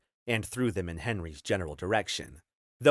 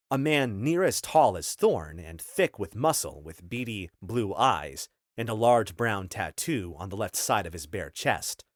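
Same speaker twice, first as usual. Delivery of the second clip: usual speaking voice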